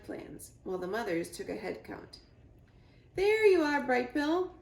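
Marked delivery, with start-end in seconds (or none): 3.1-4.6 loud, high goose honk of a call. A narrator's reading. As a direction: call across the crowd. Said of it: "Loudwing honked".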